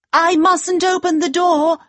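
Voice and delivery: put-on high, anxious young girl's cry. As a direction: cry it out high, like a worried girl.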